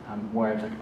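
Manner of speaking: ordinary delivery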